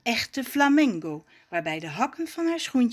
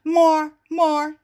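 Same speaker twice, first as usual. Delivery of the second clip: loud, higher, halting disbelief; stammering; 6 semitones higher, 8 dB louder than usual. A narrator's reading. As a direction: stumble over the word, lost for words. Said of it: "stamelt hij dan". (verbijsterd stamelen)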